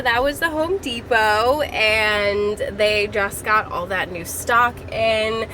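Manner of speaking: normal talking voice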